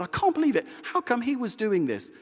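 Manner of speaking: high, indignant complaint; angry retelling. Retold or imitated. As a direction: replay venting to your wife. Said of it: "I was really angry".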